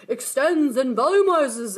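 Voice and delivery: slow, drawn-out ad announcer; mock the TV ad slogan; commercial voice. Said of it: "those commercials where you see".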